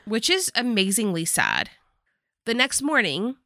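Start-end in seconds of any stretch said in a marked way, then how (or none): none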